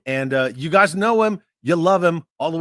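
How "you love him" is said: ordinary delivery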